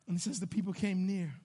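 ordinary talk

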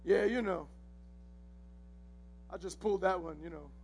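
low, soft, casual boast; mock-modest bragging, tossed off quietly; puffed-up nonchalance. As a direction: play it cool with your chest puffed up. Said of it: "Peter puffed up his chest".

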